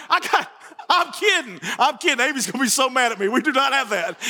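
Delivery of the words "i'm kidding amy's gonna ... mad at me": normal talking voice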